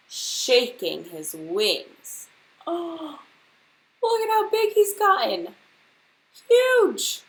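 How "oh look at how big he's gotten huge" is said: high, loud gasp of alarm; shocked Angela. Acted. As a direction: squeal it out in horror. Angela shocked at the grown bird.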